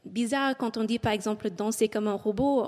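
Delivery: normal talking voice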